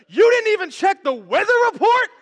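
high, loud comic tirade; yelling angry wife. Acted. Wife berating husband over the rained-out boat date, comic furious yelling. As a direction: tear into the partner, hammed-up and loud. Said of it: "And they're yelling".

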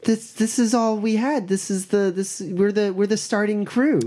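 put-on high, girlish character voice; rescued girl. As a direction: answer haltingly in a high feminine voice.

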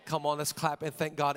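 normal talking voice

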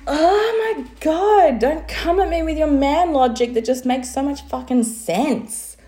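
high-pitched, drawn-out retold outburst; mock exasperation. Wife retorting to husband's man logic, comically exasperated. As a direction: wail in hammed-up protest at logic you can't fault.

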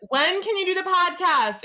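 imitating Mom's pointed question; lightly mimicked accusing demand; accusatory. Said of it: "in a very accusatory tone".

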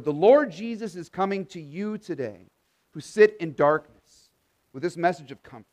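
ordinary talk

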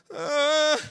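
sudden high, loud wail; imitated child's cry. Dad imitating his son waking at night, sudden cry.

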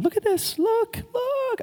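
surprised at his own high squeaky voice; silly high voice. Real, not acted. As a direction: slip into a goofy high-pitched voice by accident. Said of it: "I don't know why I'm talking like this".

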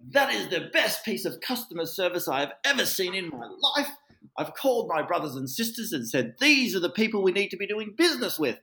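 high, loud shouted praise from the balcony; retold scream. Client shouting praise at agent from balcony, loud and high. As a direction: yell it down to the driveway, thrilled. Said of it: "he screams at the top of his lungs".

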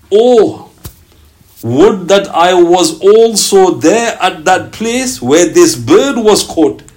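fervent slow lament; yearning recitation. Acted. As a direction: draw out the longing, slow and heartfelt. Longing saying read slowly.

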